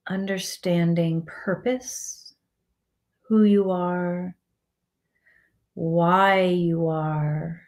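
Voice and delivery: slow, calm meditation voice with long pauses; guided meditation. Acted. Guiding listeners to relax the body and let go, slow meditative calm. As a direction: ease them into relaxation, phrase by unhurried phrase.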